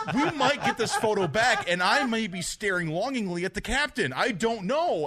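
high, worked-up retelling of nerves; mock fretting. Fan fretting to his brother about the photo, mock panic. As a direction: fret aloud, half laughing.

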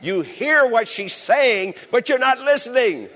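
laughing tease. Real, not acted. Teasing the husbands in the congregation.

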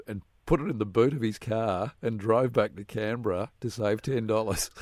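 ordinary talk

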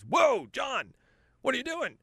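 high-pitched, startled doctor stopping the patient; alarmed doctor. Acted. Doctor to overmedicated patient, high-pitched alarm. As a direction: exclaim it like a shocked physician. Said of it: "your doctor goes".

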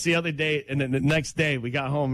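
ordinary talk, nothing marked

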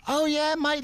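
very high, cheery mock Aussie; bad Australian accent. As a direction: answer in a hammed-up Australian squeak. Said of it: "my bad attempt at an Australian accent".

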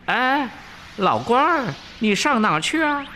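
high Monkey King hail; Wukong calling out. A narrator's reading. Wukong hails the disguised demon, high calling voice. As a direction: call out high to someone approaching. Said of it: "走上前迎着妖精叫声".

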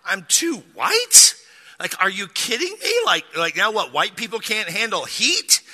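hammed-up offended disbelief; incredulous outrage. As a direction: throw it back as a scandalized question. Retold offended reaction to waitress.

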